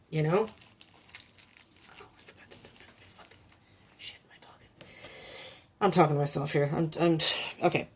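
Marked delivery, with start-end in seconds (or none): 0.5-4.7 soft muttered whisper to herself; whispered aside. Real, not acted. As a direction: whisper it under your breath, a private aside. Muttered aside to herself while shuffling.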